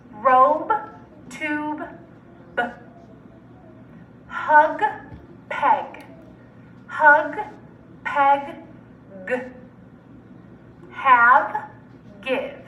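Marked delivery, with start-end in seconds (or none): 2.5-2.6 a very short B sound; just the sound of B, not the letter name; isolated final sound. Shown as a demonstration. Teacher giving students the last sound, isolated B. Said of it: "the final or last sound". 9.3-9.3 a very short hard G sound; just the sound of G, not the letter name; isolated final sound. Shown as a demonstration. Teacher giving students the last sound, isolated G. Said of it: "the final or last sound".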